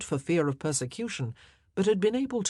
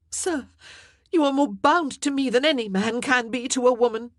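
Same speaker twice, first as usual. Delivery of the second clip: loud, high indignant retort; queen answering the king's accusation, indignant; sharp counter; 8 semitones higher, 7 dB louder than usual. Acted. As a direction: fight back against his blame. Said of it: "she countered".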